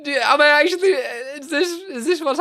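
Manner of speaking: high, laugh-cracked questions; stunned and giggling; laughing disbelief. Real, not acted. Asking chat if the emote goes up, half-crying with laughter.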